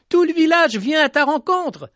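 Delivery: high, bright exclaim of joy; jubilant. A narrator's reading. Boy announcing the whole village is coming, jubilant. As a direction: burst out with joy. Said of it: "Il jubilait".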